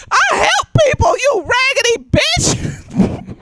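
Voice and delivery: high, slow, laughing shout; crying laughing shout. Retold or imitated. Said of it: "I was like".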